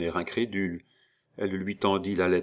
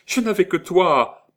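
much higher, louder half-mad wail; frantic cry; 11 semitones higher, 8 dB louder than usual. A narrator's reading. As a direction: repeat it like a woman losing her mind. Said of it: "avec une sorte de folie". (plainte folle, bien plus aiguë et forte)

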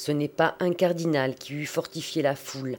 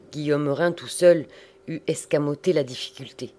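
ordinary delivery

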